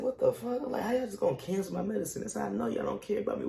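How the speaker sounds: high-pitched agitated protest; retold frantic panic. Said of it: "I'm in a nervous, frantic panic".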